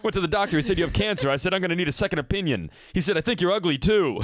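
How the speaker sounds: high-pitched one-liner comic; Dangerfield. Acted. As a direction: rattle off the gag like a stand-up. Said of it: "This is a Dangerfield joke".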